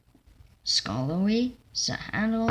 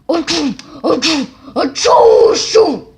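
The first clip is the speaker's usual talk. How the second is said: loud sneezes; 8 semitones higher, 17 dB louder than usual. Acted. Stewart sneezing hard.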